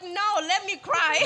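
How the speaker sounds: loud, drawn-out mock wail; defiant protest. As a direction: retell a stubborn refusal, slow and loud, near laughter. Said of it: "I'm like".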